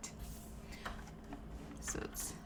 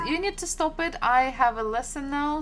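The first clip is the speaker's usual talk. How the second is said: scolding; 4 semitones higher, 7 dB louder than usual. Real, not acted. Teacher scolds a chat commenter.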